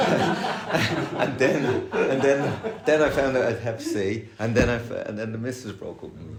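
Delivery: half-laughing list of bad luck; laughing through it. Real, not acted.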